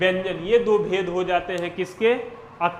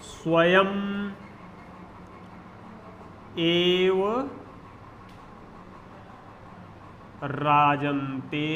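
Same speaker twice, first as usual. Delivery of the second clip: slow dictation; 4 dB quieter, much slower than usual. Shown as a demonstration. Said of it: "मैं लिख रहा हूं, आप लोग देखिएगा".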